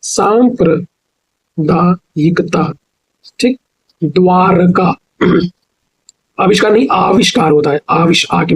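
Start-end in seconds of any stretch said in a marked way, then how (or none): 0.0-2.7 very slow, सांप्रदायिकता syllable by syllable; slow dictation. Shown as a demonstration. Teacher dictating सांप्रदायिकता slowly. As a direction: stretch सांप्रदायिकता as saam-pra-daa-yi-ka-taa. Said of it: "सांप्रदायिकता नहीं होता".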